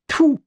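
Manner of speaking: a sharp, high spitting "tfu"; disgusted spit. A narrator's reading. Max spitting after the priest's exorcism, disgusted spit.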